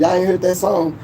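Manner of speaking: ordinary talk, nothing marked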